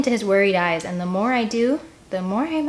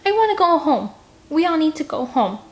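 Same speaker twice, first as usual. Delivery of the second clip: high, loud whining demand; whiny demand; 9 semitones higher, 4 dB louder than usual. Acted. Scarlet begging the group to go home, high whine. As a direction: insist like a sulky kid.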